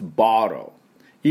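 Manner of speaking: ボトル as "bah-ro", t barely sounded; American bottle. Shown as a demonstration. Teacher showing how Americans say it, ボトル as "bah-ro". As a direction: open "bah", flapped t in ボトル. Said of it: "Eは発音しません".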